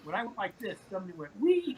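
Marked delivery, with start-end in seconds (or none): 1.4-1.8 high, loud mock squeal; taunting high-pitched "wee". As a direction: mimic a tiny voice teasing you. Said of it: "like, mocking me".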